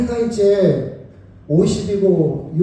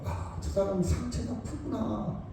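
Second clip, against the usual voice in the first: soft compassion; 3 semitones higher, 11 dB quieter than usual. Acted. Compassionate response voiced softly.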